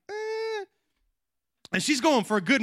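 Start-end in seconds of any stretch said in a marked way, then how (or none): 0.1-0.7 awkward wince. Retold or imitated. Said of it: "we're watching her like".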